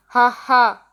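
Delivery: mock laugh. Shown as a demonstration. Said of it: "एक लड़का हंस रहा है".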